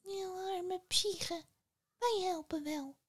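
a little ant murmuring in her ear; tiny ant whisper. Acted. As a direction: ant comforting Psyche, tiny high voice. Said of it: "in haar oor fluisterde".

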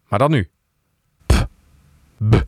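plosives popping, distorted straight into the mic. Shown as a demonstration. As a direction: blow the P and B hard into the mic. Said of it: "Het geluid wordt helemaal overstuurd".

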